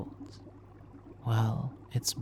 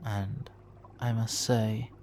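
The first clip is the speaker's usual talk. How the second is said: usual speaking voice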